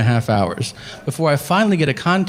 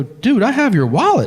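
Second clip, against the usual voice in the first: excited; 7 semitones higher than usual. Retold or imitated. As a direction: blurt it out thrilled. Excited at finding the owner.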